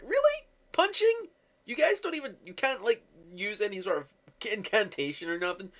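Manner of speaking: very high, squeaky incredulous mock; mock disbelief. Mocking the air-punching scene.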